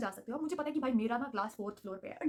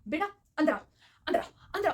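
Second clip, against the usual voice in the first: louder, higher urgent call; 4 semitones higher, 4 dB louder, faster than usual. Retold or imitated. Vice principal urgently calls student inside, louder and higher. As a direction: call her inside right now.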